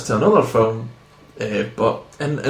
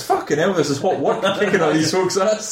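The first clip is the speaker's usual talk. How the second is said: imagined Seagal thought; 6 semitones higher than usual. Retold or imitated. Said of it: "never at one point thinks".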